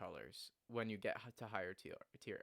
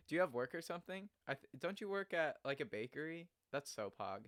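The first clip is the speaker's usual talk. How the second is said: ordinary talk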